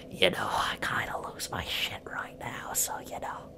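very soft, breath-only confession; whispered. Real, not acted. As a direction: whisper it as a sheepish aside. Admits it under breath.

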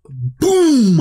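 smack out the noise of the bag hitting the floor; imitated boom. Throwing legal work on floor.

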